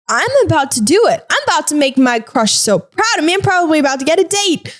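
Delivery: high, rushing hammed-up excitement; giddy anticipation. Player nearing the goal to impress her crush, mock-giddy excitement.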